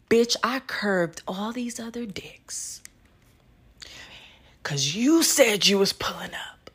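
unvoiced hushed venting; whisper. Real, not acted. Whispering her frustration to the audience. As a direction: whisper it like a confession. Venting about a cancelled hookup.

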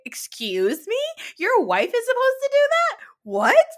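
high, loud disbelief; incredulous outrage. Acted. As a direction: fire back as if scandalised, rising to a loud finish. Mock outrage at husband's demand.

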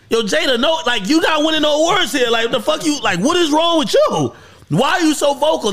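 high-pitched incredulous scolding; Chris Rock. Retold or imitated. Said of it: "so he was basically saying".

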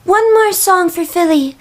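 high, put-on pop-star voice. Imitating the singer announcing an encore, high stage voice. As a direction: announce it high and cutesy to a crowd. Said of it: "like, [name]'s going".

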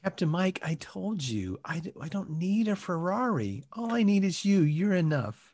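soft, tender reassurance. Acted. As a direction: quietly reassure your husband.

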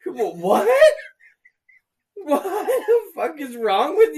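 laughing outrage at a stranger's habit; exasperated rant. Retold or imitated. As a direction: lecture them in outraged disbelief. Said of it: "And I'm like".

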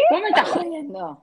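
can't-believe-it laughing outburst; incredulous laughter. Real, not acted. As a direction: laugh out your shock at a friend's story. Friend reacts to breakup story, laughing.

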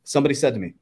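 ordinary delivery

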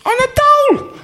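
high, loud squeal of disbelief; incredulous outrage. Retold or imitated. Retelling the wage offer with a high outraged squeal. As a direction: shriek it like the offer is an insult.

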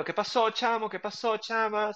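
lilting Venezuelan Spanish from Caracas; demonstrated Venezuelan street greeting in Caracas accent. Shown as a demonstration. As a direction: show off a Caracas accent, high-pitched on the greeting. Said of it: "Nuestro acento es así, tal, de Caracas".

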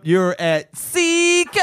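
hammed-up greeting with "Seacoast" drawn right out; exaggerated stretched delivery. Shown as a demonstration. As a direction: sing out "Seacoast" in a high, slow call. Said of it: "That's the way I say it".

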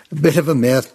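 chuckling through the words; laughing. Real, not acted. Laughing at his own tale.

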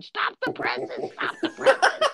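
piercingly high falsetto panic; mock yelling. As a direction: squeal at the machine in comic horror. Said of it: "was yelling at his machine".

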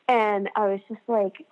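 normal talking voice

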